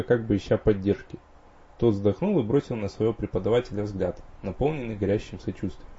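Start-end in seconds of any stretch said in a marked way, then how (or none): none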